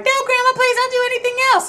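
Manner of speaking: put-on very high, fast pleading child voice; pleading kid. Boy hurriedly begging grandma, very high and fast. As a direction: blurt the plea quickly in panic. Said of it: "I was like".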